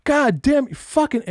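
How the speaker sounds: high, slow furious outburst; imitated cursing tirade. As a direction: shriek the curses like a coworker losing it. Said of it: "some dude is just going off".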